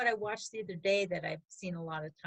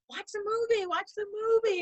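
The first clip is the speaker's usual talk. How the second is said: high, louder mock nagging; mock insisting husband; 12 semitones higher, 6 dB louder than usual. She mimics her husband insisting, high and louder. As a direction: repeat it like a pushy husband. Voicing Mark during scary movie.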